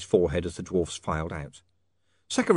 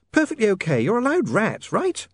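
high woman's reassurance; coaxing young woman; 12 semitones higher, 4 dB louder than usual. Acted. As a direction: reassure him in a high, insistent voice. Sacharissa coaxes Otto to eat.

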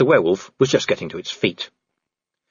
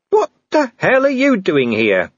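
incredulous demand; 9 semitones higher, 3 dB louder than usual. Acted. Vimes demands an explanation.

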